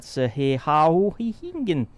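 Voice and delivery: "Sehihauhihingen" as a mangled, swooping name; deliberate mispronunciation. Shown as a demonstration. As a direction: garble "Sehihauhihingen" on purpose with a soaring middle. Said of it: "pronounce it differently each time".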